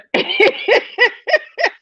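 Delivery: a high rising burst of laughter. Real, not acted. Viewer claims to be a singer-songwriter, host bursts out laughing. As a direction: lose it completely.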